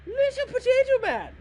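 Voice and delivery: put-on Irish accent shouted very high and loud, drifting Scottish; a hammed-up Irish yelp that slips into Scottish; loud, squeaky attempt at an Irish accent; bad Irish accent. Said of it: "I can't do Irish. My Irish, it sounds more like Scottish".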